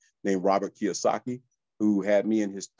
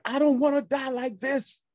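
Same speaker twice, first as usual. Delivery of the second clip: retold scream; 14 semitones higher than usual. Driver who lost everything cries out in despair, high-pitched. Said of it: "I screamed one time".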